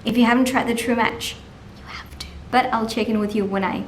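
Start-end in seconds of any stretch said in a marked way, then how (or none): 1.8-2.3 hushed whisper at work. Real, not acted. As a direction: keep it to a whisper. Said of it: "I'm sorry I have to whisper".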